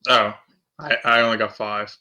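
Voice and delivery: ordinary talk, nothing marked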